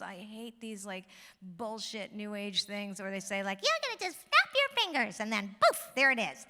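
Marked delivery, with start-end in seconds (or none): 3.6-6.4 high, loud mock new-age enthusiasm; mocking imitation. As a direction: send up a too-easy magical promise, high-pitched. Mocks new age promises.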